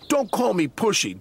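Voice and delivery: high, cross retort; snapping back. Acted. Douglas snapping back at his twin, cross. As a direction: throw it right back. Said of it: "Douglas snapped back".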